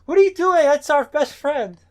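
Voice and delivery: high, loud mock outcry; shocked protest. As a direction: imitate sisters aghast at an attack. Sisters protesting an attack, acted.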